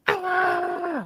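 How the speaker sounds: imitated high-pitched, shrillish scream; very high, held mock scream; little-girl scream. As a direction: squeal like a terrified little girl. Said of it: "high-pitched, shrillish little girl scream".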